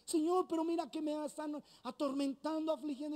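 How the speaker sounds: put-on a worried child begging for help; anxious plea. Believer begging the Lord for help, anxious and pleading.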